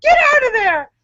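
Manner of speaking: high, fast, loud scolding yell; re-enacted yell. Acting out yelling at the raccoon on the roof, mock yell. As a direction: yell it high as if chasing off an animal. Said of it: "I yelled, and I'm like".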